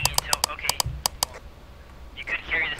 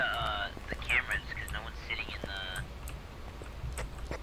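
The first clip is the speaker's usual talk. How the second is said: whisper; 7 semitones lower, 7 dB quieter, much slower than usual. Real, not acted. Trails off whispering.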